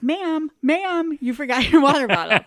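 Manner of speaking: high, loud call across a crowd. Retold or imitated. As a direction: call out to get a stranger's attention. Calling out to aunt over crowd.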